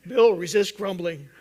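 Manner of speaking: loud teasing call to Bill; loud, joking nudge to a friend; playful mock rebuke. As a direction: tease him out loud in front of everyone. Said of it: "I would be".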